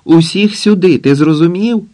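гучніше й вище, зривається; кричи роздратовано й нервово; психує. A narrator's reading. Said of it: "Психує". (нервовий викрик)

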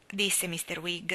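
ordinary delivery, nothing marked

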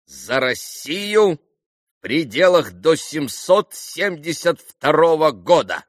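loud, slow, declamatory toast; booming. A narrator's reading. Vrublevsky raising a pointed toast, booming. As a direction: declare it in a stentorian voice. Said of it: "зычным голосом проговорил".

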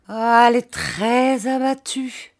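woeful husband; distressed lament. A narrator's reading. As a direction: bemoan it with drawn-out emphasis. Distressed husband reports on his wife.

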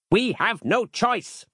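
high, slow, stern declaration; stern, drawn-out command; stern bark. A narrator's reading. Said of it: "barked Harland sternly".

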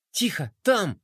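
usual speaking voice

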